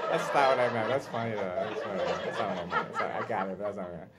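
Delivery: low, trailing-off self-correction; muttered aside. Real, not acted. Comedian correcting his own cat bit, low muttered aside. Drops act to make aside.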